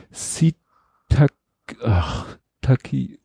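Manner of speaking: foggy, groping for words; head pounding, searching for the word; hungover. Real, not acted. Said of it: "Mein Schädel dröhnt ein bisschen".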